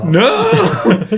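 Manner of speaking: high, laughing cry of refusal; mock dismay. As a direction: protest in playful horror. Mock dismay at announced match.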